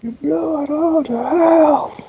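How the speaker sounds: movie quote. Acted. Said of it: "You maniacs".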